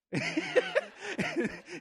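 high burst of laughter; big laugh. Real, not acted. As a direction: let the laugh break out. Laughs after telling the airport line.

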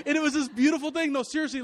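high, loud laugh carrying into the words; laugh, then speak through it; real laugh. Real, not acted. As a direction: pastor laughing at his own story of the flood. Laughing while retelling.

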